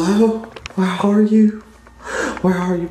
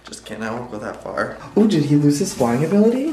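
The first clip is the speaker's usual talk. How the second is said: ordinary talk, nothing marked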